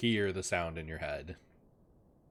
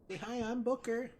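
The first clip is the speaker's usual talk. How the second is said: very high dog voice; high-pitched cute pet voice; Booker. Acted. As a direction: speak for your pup in a squeaky voice.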